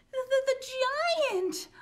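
high loud stammering cry; hammed-up fright; terrified stammer. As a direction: choke on the words in fear. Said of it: "Olive whispered".

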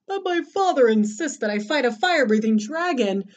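whiny storybook complaint; acted, plaintive protest; moaning knight. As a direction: moan it out as a reluctant knight. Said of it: "George moaned".

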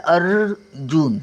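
अर्जुन stretched out slowly, the र heard after अ; teacher drawing attention to how अर्जुन is spoken; slow demonstration. Shown as a demonstration. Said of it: "अ पण पूर्ण आला".

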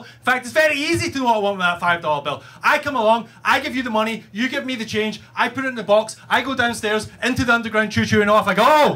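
fast exasperated rant, gathering height; losing it. Acted. Rider explaining the fare routine to the clerk, exasperated rant. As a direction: spell it out in a mounting tirade. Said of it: "I lost my shit, guys".

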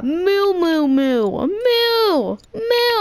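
playful cow noises going up; cow mooing. Pretend. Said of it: "I'm a cow".

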